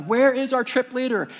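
high, impatient group question; antsy, high-pitched demand. Retold or imitated. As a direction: imitate anxious travelers wanting an answer. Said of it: "they're all antsy".

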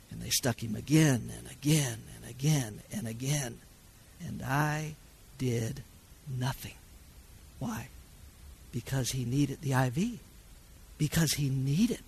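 soft, subdued confession with long pauses; hushed. Real, not acted. Father admitting he stood by during his son's pain, hushed. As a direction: drop right down and let each phrase hang. Father recalls standing by helplessly.

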